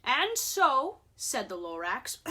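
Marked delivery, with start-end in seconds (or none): 0.0-1.0 raised voice. Acted. Lorax continues his complaint.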